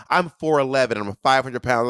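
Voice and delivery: ordinary delivery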